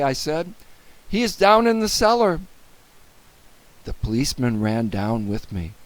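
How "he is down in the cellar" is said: high, loud and quick; urgent. A narrator's reading. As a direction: hurry the officer along. Boy directs policeman urgently.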